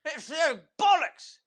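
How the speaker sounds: very high, put-on prim voice; hammed-up genteel cursing; very proper uncle. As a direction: curse properly in a squeaky-high voice. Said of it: "Very proper".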